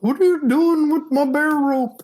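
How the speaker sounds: hammed-up high voice of an angry hiker; Wolfman's voice. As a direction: pitch it up and bark the question like Wolfman. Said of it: "that's how he talked".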